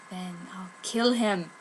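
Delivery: low, soft, slow threat; menacing threat. Acted. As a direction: threaten coldly. Jealous villain threat.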